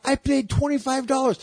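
high, squeaky indignant protest; indignant guy. Retold or imitated. Guy protesting he paid for the shirt, high squeaky whine.

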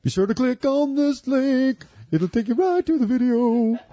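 high mock crooner voice; high silly put-on voice. Mock [name] voice.

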